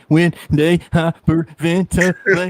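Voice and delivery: imitating a kid's hiccupping sobs, drawn out; mock hyperventilating cry; hyperventilating little-kid cry. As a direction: gasp between syllables like a sobbing child. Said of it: "little kid cry when they hyperventilate".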